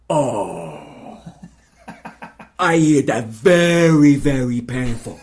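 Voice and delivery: slow, loud Filipino nurse voice; Filipino accent. Retold or imitated. Nurse reacting to pancreatic cancer, slow doom-laden Filipino accent. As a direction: drag it out in a heavy Filipino accent, full of pity. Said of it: "that's a very good Filipino accent".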